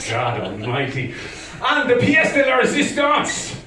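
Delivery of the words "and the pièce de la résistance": high, loud showman's build-up; grand announcement. Acted. Comic unveiling the best Irish invention, grandly. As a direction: proclaim it with mock grandeur.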